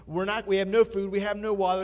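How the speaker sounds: castaway baffled, still panicking; dumbfounded panic. Acted. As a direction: shout in disbelief. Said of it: "still dumbfounded".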